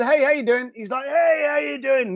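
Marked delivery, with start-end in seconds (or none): 1.1-2.1 high, drawn-out New York greeting; New York guy. Retold or imitated. Caller greets Steve on the phone, New York guy. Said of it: "New York guy".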